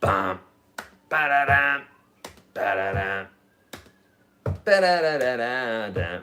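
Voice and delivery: rapid-syllable drum rolls with gaps between; mouthed drum fills. Shown as a demonstration. Teacher demonstrating a basic drum beat, vocalised rolls. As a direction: imitate drum hits with "ba-da-dum". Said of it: "It would be just your basic".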